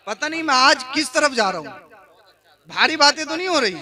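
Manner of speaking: ordinary talk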